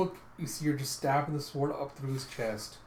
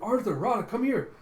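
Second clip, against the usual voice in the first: high, loud cry from afar; acted shout to companions; calling out; 8 semitones higher, 7 dB louder than usual. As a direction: summon friends urgently from above. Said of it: "you hear a cry from above".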